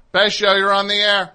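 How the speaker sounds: loud, high host call-in intro; radio host announcer. Acted. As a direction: boom out the caller intro. Radio host announces next caller.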